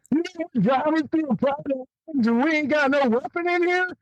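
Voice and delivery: high, disbelieving question; asking the safari guide, high and incredulous. Retold or imitated. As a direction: pitch it up in disbelief.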